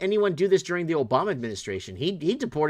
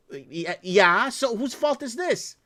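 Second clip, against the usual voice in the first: slow, higher-pitched incredulous comeback; drawn-out, mock-puzzled pushback; retold indignant challenge; 4 semitones higher, much slower than usual. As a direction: re-enact challenging the bank, pitch climbing into the question. Retelling own challenge to bank staff.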